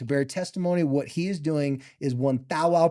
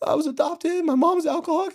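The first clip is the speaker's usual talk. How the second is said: much higher, pleading confession; confessing to coach; 12 semitones higher, 3 dB louder than usual. Retold or imitated. A young player confessing secrets to his coach, high and pleading. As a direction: pour the secrets out, high and strained. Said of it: "I'm like".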